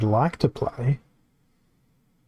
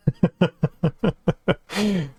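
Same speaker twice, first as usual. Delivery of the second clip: high laugh at his own joke; bright laugh; 6 semitones higher than usual. Real, not acted. Streamer laughing at a viewer's gif joke, real laugh. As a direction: laugh it off, amused.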